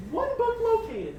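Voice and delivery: robot owl voice. Acted.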